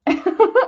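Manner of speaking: loud rising laugh; high laugh. Real, not acted. Crafter remembering making Avril open packaging, laughing. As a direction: laugh out at the memory.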